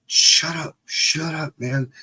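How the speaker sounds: high, urgent mock scolding; imitated panicky shushing. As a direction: shush a bawling kid before parents hear. Hushing crying brother, retold.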